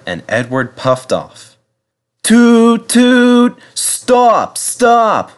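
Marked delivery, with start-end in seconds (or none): 2.2-5.3 high, loud wail of a little bus. A narrator's reading. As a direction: cry out after the train, much higher and louder. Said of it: "wailed Bertie".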